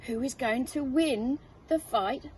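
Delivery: plain reading voice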